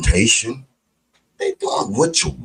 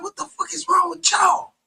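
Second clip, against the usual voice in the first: exasperated shout. Retold or imitated. Answering believers who push the Bible, exasperated shout. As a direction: throw up your hands and yell it. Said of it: "I be like".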